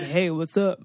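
low put-on guy voice; mock-manly casual greeting. Shown as a demonstration. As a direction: drop it down like a dude. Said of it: "a manly voice".

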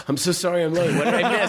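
cheeky late arrival; innocent late guest. Retold or imitated. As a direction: act like nothing happened. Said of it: "Was like".

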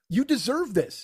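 high, slow, emphatic scolding; mock-indignant, deliberate; unforgiving retort. Acted retort to laughing friend.

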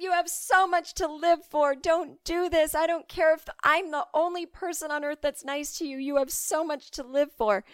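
earnest, overwrought pleading to a man on a rock; urgent pleading. Acted. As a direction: beg a stranger not to jump. Pleading with supposed suicidal jumper.

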